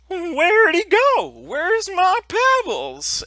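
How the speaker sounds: bewildered giant calling out, way up high; Grontho. Acted. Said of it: "Grontho is like".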